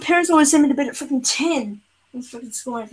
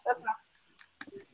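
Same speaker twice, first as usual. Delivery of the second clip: slow, much softer, trailing away; muttered repeat; 4 semitones lower, 16 dB quieter, much slower than usual. Real, not acted. Repeating to the arguing friend, slow and much softer. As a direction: say it again under your breath, drawn out.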